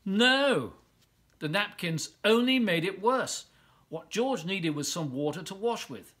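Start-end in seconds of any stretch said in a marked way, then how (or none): 0.0-0.7 higher, louder dramatic no; emphatic no. A narrator's reading. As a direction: punch it like a story twist. Napkins make it worse.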